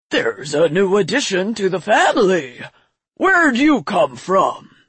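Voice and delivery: drawn-out, swooping creature voice; dragon patriarch. A narrator's reading. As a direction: boom it slowly like a grand old dragon, curious. The dragon Patriarch addresses a newcomer.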